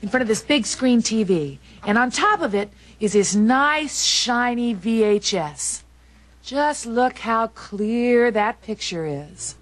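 slow, lingering sales-pitch purr; seductive coaxing. Acted. Wife tempting husband with a big screen TV, seductive. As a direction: stretch out the words to tempt him.

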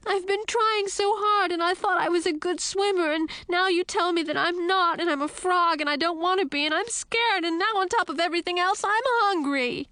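high-pitched tearful tirade; wailing. A narrator's reading. Princess pouring out her troubles to Frog, high wail. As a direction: wail it all in one high, running complaint. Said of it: "I wailed".

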